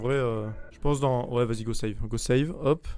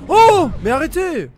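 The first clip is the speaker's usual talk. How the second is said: startled cry; 13 semitones higher, 8 dB louder, slower than usual. Real, not acted. Startled, protesting.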